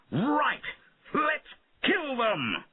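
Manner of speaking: high, slow war cry; rally the men to charge; young king urging his knights to attack, high; battle shout. A narrator's reading. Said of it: "he shouted to his men".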